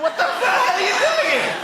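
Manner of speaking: high, loud exasperation; exasperated comic yell; exasperated outburst. Acted. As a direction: blurt it out in high-pitched disbelief. Exasperated reaction to long sign.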